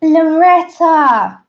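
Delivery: loud, high, drawn-out name call; big welcoming call; calling a greeting. Real, not acted. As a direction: hail a friend as they arrive, stretching the name. Calling out to arriving viewer.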